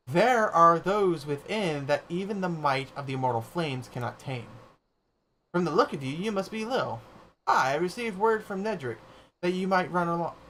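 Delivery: lightly voiced grave gatekeeper, ceremonial; a hint of a stately keeper of ruins; solemn gatekeeper. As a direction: announce it like an old guardian at a gate.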